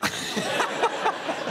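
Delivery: breathless wheezy laugh; a runner's breathless wheeze; panting laugh. Retold or imitated. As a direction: mimic gasping for air while running. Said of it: "literally going".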